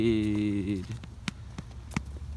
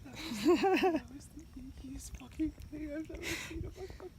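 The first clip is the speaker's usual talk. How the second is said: high whispered giggle; 11 dB quieter than usual. Real, not acted.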